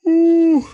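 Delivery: loud, very high hoot; sudden high "ooh". Real, not acted. Vlogger early in the morning, involuntary high loud ooh. Sudden noise, then apologizes.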